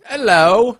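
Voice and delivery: loud, high British hello; a mock British hello; hammed-up British accent. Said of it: "speak with a British accent".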